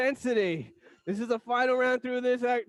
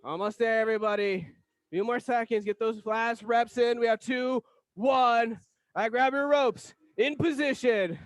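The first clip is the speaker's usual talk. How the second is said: usual speaking voice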